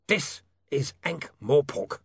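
sharp slow snap; snapped. Acted. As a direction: bite off each word. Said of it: "snapped the lawyer".

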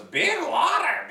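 very high, slow, stretched bird cry; squawky bird voice. Retold or imitated. Podcaster imitating the gull calling for the sea, very high squawk. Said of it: "He goes".

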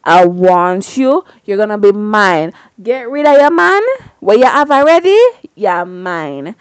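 loud, pushy come-on, hammed up; loud mock serenade. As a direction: belt it out like a cheesy seducer. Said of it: "Daddy serenaded this man".

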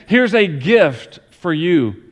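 slow, leaning hard on "gift"; slow, pointed "gift" to a daughter; stress on gift. Retold or imitated. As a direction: drag out "Here's a gift for you", pressing "gift". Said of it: "I emphasize gift over and over".

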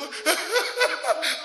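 loud, high acted sobs; short pretend crying outburst; mock sobbing. Shown as a demonstration. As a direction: burst into hammed-up bawling. Demonstrates crying while praying.

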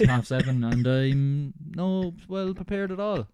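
hammed-up hopelessness; laughing despair. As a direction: drawl it out in mock despair. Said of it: "It's like".

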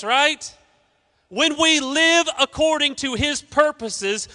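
high, loud fired-up sermon; full-force preaching. Acted. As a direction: preach it to the back row.

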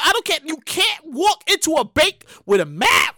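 ordinary talk, nothing marked

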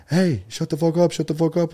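high mock yell bursting into the room; retold scream. Friend bursts in yelling at the group, mock scream. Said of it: "comes in screaming in the room".

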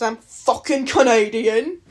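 put-on loud, accidentally British accent. Vlogger joking about being Canadian, British accent. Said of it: "That was British".

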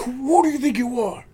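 mock Roman Reigns. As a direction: sneer it like a cocky champion. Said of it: "he's like".

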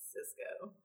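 whispered. Real, not acted. Whispered suggestion.

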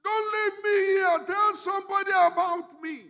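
high, loud desperate plea; shouted plea. Acted. Joseph in prison shouting to the butler, high desperate plea. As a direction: shout after him, begging not to be left. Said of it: "Joseph shouted to him".

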